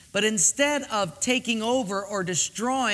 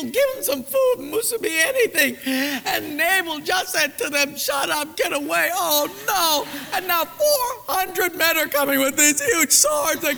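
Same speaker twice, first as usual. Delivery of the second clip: panicked servant; 6 semitones higher than usual. Acted. Servant panicking to Abigail about the coming swords. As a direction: blurt it out in a hammed-up panic.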